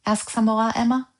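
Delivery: plain reading voice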